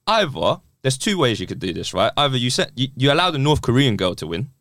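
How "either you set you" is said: normal talking voice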